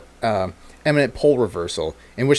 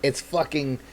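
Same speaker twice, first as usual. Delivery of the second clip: normal talking voice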